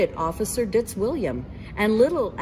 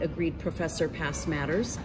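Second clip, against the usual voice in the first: plain reading voice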